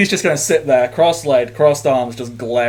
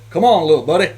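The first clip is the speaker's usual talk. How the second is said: louder, higher encouraging call; a thumbs-up cheer for a small robot; 5 semitones higher, 8 dB louder, faster than usual. Acted. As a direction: cheer the little buddy on. [name] cheers robot on.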